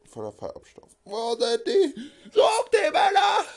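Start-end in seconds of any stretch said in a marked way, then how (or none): 1.0-2.1 high, loud, slow crying call; frantic cry. Acted. Boss searching the station for them, crying out high. 2.3-3.5 very high, loud shrieked command; shouted order. Acted. Boss orders henchmen to find the men, shrieking.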